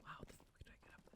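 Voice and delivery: fast whispered aside; hurried hushed whisper. Real, not acted. Sneaking, whispering to self.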